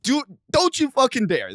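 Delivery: high, forceful dare-you threat; heated warning. Retold or imitated. Forbidding a stalker from showing up, heated warning.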